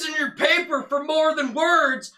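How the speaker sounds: high, loud blustering impression; booming mock taunt; Chris Farley. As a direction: bellow it like a sketch-comedy motivational speaker. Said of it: "That's a pretty good impression".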